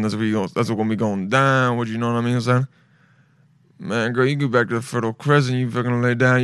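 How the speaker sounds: low, mock smooth-talker voice; low seductive voice. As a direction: play it like a hammed-up lover, voice dropped low. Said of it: "You have to have a really low voice".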